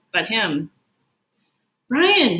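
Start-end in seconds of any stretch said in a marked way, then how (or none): 1.9-2.4 higher, louder call across the lunchroom; boy shouting a friend's name. Acted. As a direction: shout his name to get his attention. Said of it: "he hears someone shout".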